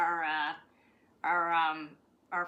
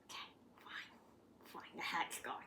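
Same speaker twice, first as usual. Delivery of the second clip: whisper; 5 semitones lower, 17 dB quieter than usual. Real, not acted. Soothing upset dog.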